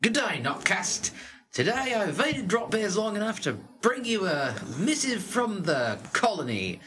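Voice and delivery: comic mock Australian, drawing out the vowels; Australian accent. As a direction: put on a hammed-up Australian voice. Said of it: "in an Australian accent".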